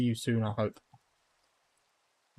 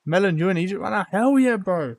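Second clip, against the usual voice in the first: loud, fast and higher, hyped to see a friend; excited greeting; 6 semitones higher, 6 dB louder than usual. Real, not acted. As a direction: greet him like a buddy back from a trip. Greeting a chat viewer excitedly.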